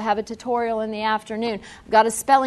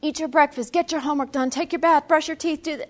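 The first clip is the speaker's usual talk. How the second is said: higher-pitched rattled-off commands; barking orders; 4 semitones higher than usual. Shown as a demonstration. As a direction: mimic a drill-sergeant parent listing chores. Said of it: "They are going to bark orders".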